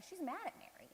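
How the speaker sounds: ordinary delivery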